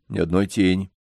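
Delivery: plain reading voice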